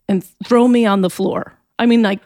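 usual speaking voice

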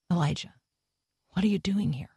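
hushed, spaced-out voice of God; low whisper. A narrator's reading. God speaking to Elijah at the cave, low whisper. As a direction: drop into a whisper after a pause. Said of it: "of a low whisper".

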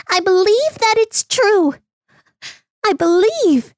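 high, eager donkey voice; donkey bursting out. Acted. Donkey telling the man he believes, high and eager. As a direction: blurt it out with joyful faith. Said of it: "The donkey burst out".

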